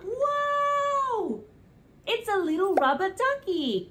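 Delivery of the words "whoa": surprised whoa. Acted. Toy revealed.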